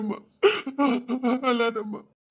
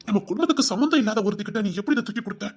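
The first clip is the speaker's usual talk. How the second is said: fast, indignant questioning; heated rapid accusation; much faster than usual. Acted. Father rapidly accusing her of giving it to an outsider. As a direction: fire the accusation quickly.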